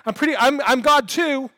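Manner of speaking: high, loud boasting; hammed-up self-promotion; mock attention-seeker. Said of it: "doesn't show up on the scene and go".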